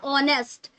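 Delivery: "ऑनेस्ट" with a vowel onset, no h; silent h. Shown as a demonstration. Teacher repeats the model for students, "ऑनेस्ट" with silent h. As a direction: repeat "ऑनेस्ट" without any h. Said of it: "ऑनेस्ट बोलते हैं ना".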